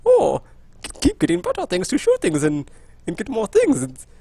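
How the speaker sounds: mock-posh professor accent; hammed-up posh professor accent; science professor accent. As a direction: put on a classy scientist accent. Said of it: "science professor voice of like".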